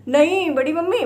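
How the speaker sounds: lightly voiced child shouting aunt; child calling out. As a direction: call like an excited child.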